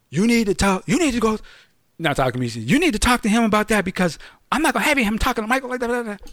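high, fast, insistent manager voice; insistent boss. Retold or imitated. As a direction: rattle it off like a pushy boss.

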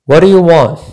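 loud, firm challenge to the stranger; firm demand. Retold or imitated. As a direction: demand it loudly, standing your ground. Confronting the strange man at night.

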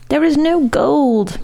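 moaning. A narrator's reading. Maestro confessing to the Count, moaning. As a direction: draw it out as a plaintive moan. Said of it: "moaned the maestro".

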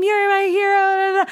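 very high, cheering squeal; cheering crowd. Retold or imitated. Volunteers cheer the runner at the chute, squealing high. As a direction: cheer her on like a fan. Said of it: "people cheering".

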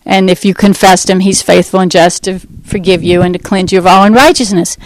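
usual speaking voice

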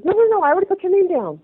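fast, high, eager no-no-no; hurried cousin. Retold or imitated. Cousin tells her the name is already down, fast and high.

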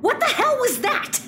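high-pitched disbelief; incredulous scolding. Acted. Plumber scolds partner for botched bluff, incredulous.

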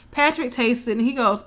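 ordinary delivery

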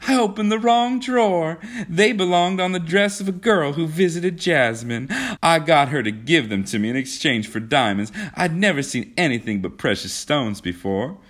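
high, giddy explanation settling down; acted merriment, pitch gradually falling; amused confession. A narrator's reading. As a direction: start high and loud, ease as you explain. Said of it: "she broke into a laugh".

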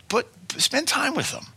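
the whole phrase jumps way up high; high strained plea; high pleading. Acted. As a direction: cry out spend time with them high above the first take. Repeated plea, pitch jumps high.

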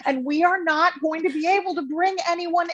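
mock-horrified panic, pitched very high. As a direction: gasp it out, horrified and half laughing. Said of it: "And I'm like".

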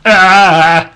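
high, slow goofy pizza-shop guy; goofy high pizza man hawking pies; silly Arnold voice. Pretend. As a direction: ham up a dopey shop owner.

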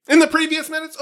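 high, sweeping recap intro like a song; dramatic announcer. Acted. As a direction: open the recap as if about to sing. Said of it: "it sounded like you were gonna start singing".

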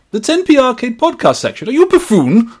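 high, loud, indignant Victorian gentleman. Acted. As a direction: berate the assistant in a Victorian gentleman's accent. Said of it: "best read in a Victorian gentleman's accent".

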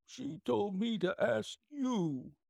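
high, slow plaintive whine; whining little man. A narrator's reading. Whining little man answers the salesman.

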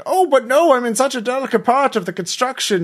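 high, fussy protest in character; protesting uncle. Acted. Uncle refuses to leave his construction, high protesting voice. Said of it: "He's like".

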